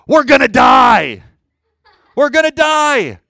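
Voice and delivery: high, loud, drawn-out cry of doom; panicked disciples' shout. Acted. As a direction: scream it out as if the boat is sinking. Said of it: "Or we would say".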